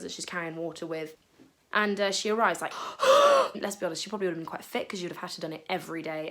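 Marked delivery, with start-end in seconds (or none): 2.8-3.5 big hammed-up gasp, high and loud; exaggerated gasp. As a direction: gasp like you're worn out from the walk. Dramatic gasp of the tired woman.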